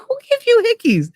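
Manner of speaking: high-pitched mock astonishment; nosy astonishment. Friend asks about the hickeys, high astonished voice. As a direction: imitate a friend's high, scandalized question. Retold friend demanding gossip.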